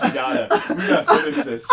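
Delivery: whispered and fast through laughter; laughing. Real, not acted. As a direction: push through the giggles. Laughing while urging.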